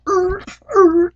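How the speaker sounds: two very high, loud screeches; imitated bus jerks. Mimicking the driver jerking the bus, loud squealing noises. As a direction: screech like brakes jabbed twice.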